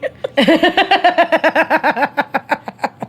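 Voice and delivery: high, long burst of laughter at the memory. Real, not acted. Laughing at date's reaction.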